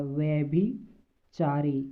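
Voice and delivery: slow careful repeat of व्यभिचारी; slow model word. Shown as a demonstration. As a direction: linger on each syllable of व्यभिचारी. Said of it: "व्यभिचारी होता है".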